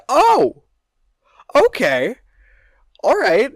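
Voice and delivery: a high, loud, surprised oh, then okay. Retold or imitated. Retelling the crowd meeting the stranger, surprised. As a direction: play the crowd caught off guard.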